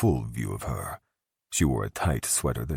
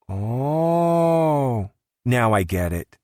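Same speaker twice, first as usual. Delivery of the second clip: knowing realization; 10 semitones higher, 5 dB louder, much slower than usual. A narrator's reading. Woman realizes with innuendo.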